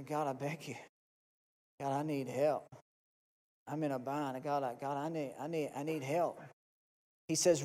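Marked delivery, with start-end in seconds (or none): 0.0-6.4 softer, halting plea to God; hushed desperate prayer. Acted. A man in a bind pleading with God, hushed and halting. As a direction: beg God under your breath, stumbling.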